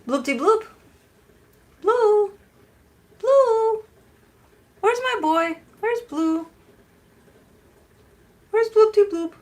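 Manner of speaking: high, loud sing-song pretend search call; calling out for the dog. Owner calls her dog as if he's missing, high loud sing-song call. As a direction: call out high as if he has vanished. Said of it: "Call for his name as if he's not around".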